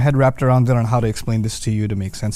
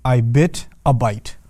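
bite; 2 semitones lower, slower than usual. Shown as a demonstration. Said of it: "it'll sound something like this in English".

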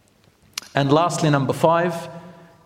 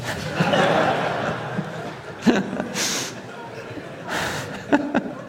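breathy high giggle at his own joke; laughing at own punchline; 9 semitones higher, 5 dB quieter than usual. Real, not acted. Preacher laughing at the joke he just told, real laugh.

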